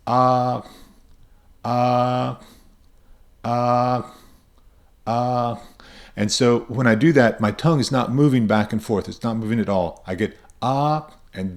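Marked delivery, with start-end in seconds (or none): 0.1-5.6 steady ah all the way until the sound stops; ah to the end. Shown as a demonstration. Teacher holds ah steady to the end. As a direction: keep ah unchanged to the end. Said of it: "I want to hear ah all the way to the end".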